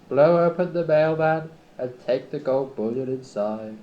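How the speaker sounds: hammed-up low conspiratorial plea; pleading villain. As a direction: sweet-talk him into helping a robbery.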